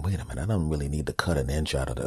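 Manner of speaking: low, soft, quick self-talk; rationalizing. Acted. Teenager talking himself into keeping the cheesesteak, softly rationalizing. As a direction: reason it out under your voice. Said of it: "And then I started rationalizing".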